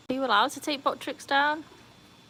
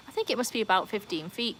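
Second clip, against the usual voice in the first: normal talking voice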